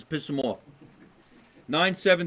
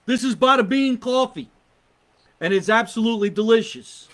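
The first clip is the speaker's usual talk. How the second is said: ad read; 6 semitones higher, 6 dB louder than usual. Acted. Product shot ad read.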